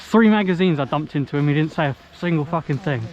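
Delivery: loud indignant complaint; angry rant. Real, not acted. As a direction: rant it loudly. Complaining angrily to teammates.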